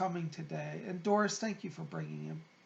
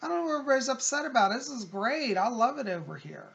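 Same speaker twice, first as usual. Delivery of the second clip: quick, higher, louder happy quote; cheerful brother; 5 semitones higher, 6 dB louder, faster than usual. Retold or imitated. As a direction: rattle it off, delighted to be there. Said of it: "he's, like, kinda laughing".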